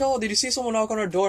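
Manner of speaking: high-pitched mock neighbor voice; neighbor calling out. Neighbors call down to kids after a knock, calling out.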